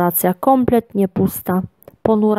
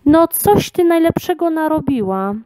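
mildly cross, higher at the start; angry lion; 7 semitones higher than usual. A narrator's reading. Lion tells the girl off for turning back, mildly angry. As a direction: scold with a touch of anger. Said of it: "zezłościł się lew".

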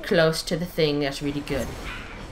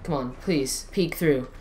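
ordinary talk, nothing marked

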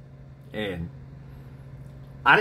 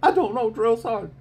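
near tears; 13 semitones higher, 5 dB louder than usual. Retold or imitated. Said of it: "I was about to start crying".

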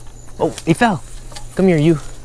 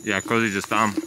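eating crackers; 4 dB quieter than usual. Real, not acted. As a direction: mouth full of crackers. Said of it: "sorry, I'm eating crackers".

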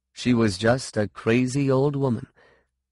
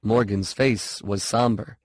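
ordinary delivery, nothing marked

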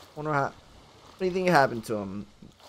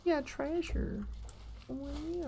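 soft, high-pitched little cheer; hushed glee; 11 semitones higher, 11 dB quieter than usual. Real, not acted. Player finding treasure, soft high gleeful coo.

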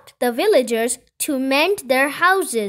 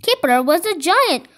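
usual speaking voice

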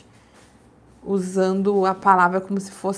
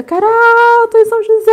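mock shout; 12 semitones higher, 8 dB louder than usual. Host shows viewers how to yell from the Dutra, pretend shout. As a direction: shout "Carol" much higher and louder out a car window. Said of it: "abre a janela e grita".

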